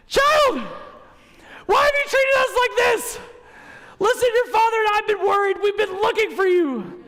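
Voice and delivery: put-on high, loud frantic mom scolding her found child; Kevin's mom from Home Alone. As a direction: shriek it like a panicked mom who just found her lost kid. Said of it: "like Kevin's mom from Home Alone".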